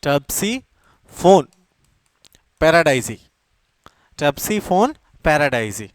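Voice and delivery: Topsiphone paradisei drawn out very slowly, syllable by syllable; teacher dictating a scientific name, very slowly; slow dictation. Shown as a demonstration. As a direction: spell it out slowly so students can write it down. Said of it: "इसका scientific name है".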